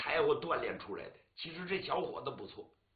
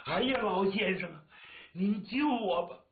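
a louder, higher desperate plea; 5 semitones higher, 4 dB louder than usual. Acted. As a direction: beg the old man on his knees. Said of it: "武吉来了就跪下了". (跪地急切哀求)

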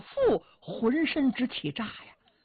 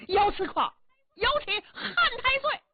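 frantic calling; 12 semitones higher than usual. Acted. Jia Fu desperately yelling for the silent Yao Qi. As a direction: yell his names in real alarm. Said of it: "贾父真急了".

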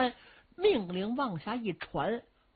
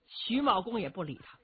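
ordinary delivery, nothing marked

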